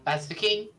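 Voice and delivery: king voice. Acted. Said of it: "I need to make a voice for this character".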